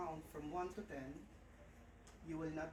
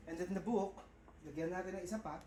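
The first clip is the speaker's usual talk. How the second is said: usual speaking voice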